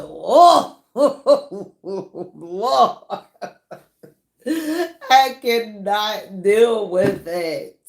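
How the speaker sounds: laughing, can't take it; helpless laugh on "Oh, Lord"; laughing disbelief. Retold or imitated. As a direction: laugh through an overwhelmed reaction. Laughing reaction quoted to viewers.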